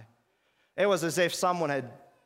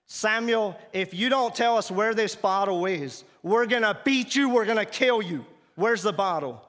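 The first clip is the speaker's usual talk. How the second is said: high, loud shouted threat; angry threat; 5 semitones higher, 5 dB louder than usual. Retold or imitated. Camp chief threatening to beat and kill Samuel. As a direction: yell the threat in fury.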